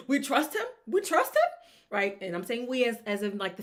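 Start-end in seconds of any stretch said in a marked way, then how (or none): 0.1-0.7 laughing, hopeful, higher; a laughing fan's rising hope. Retold or imitated. Fans waiting on the kick, laughing with hope. 0.9-1.5 much higher and louder, pleading; fan crying out in faith. Retold or imitated. Fans before the kick, crying out in high belief.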